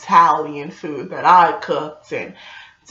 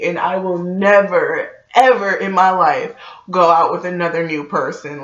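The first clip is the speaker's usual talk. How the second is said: normal talking voice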